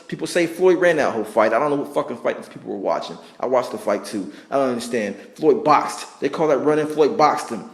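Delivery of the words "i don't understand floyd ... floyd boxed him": ordinary talk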